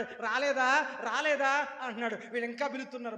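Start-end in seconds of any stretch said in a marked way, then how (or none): 0.0-1.8 loud taunting call, not come yet; mock the prophets loudly from the shade. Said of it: "ఏలియా గారు ... అంటున్నాడు". (వెక్కిరింతగా గట్టిగా అడగడం)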